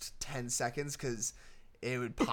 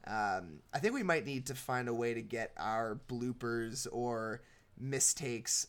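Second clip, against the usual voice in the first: usual speaking voice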